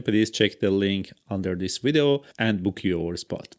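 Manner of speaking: upbeat promo announcement; ad read. Acted.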